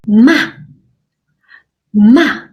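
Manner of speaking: ma said with a sharp falling fourth tone. Shown as a demonstration. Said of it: "the last one is a falling tone".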